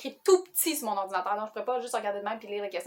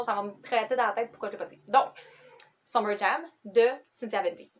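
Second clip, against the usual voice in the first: normal talking voice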